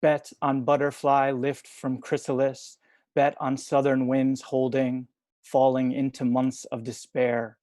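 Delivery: measured poem recital with pauses between lines; poetry reading. Acted. Poet reading short poems to an online audience, measured recital. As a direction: read each line deliberately, leaving space after it.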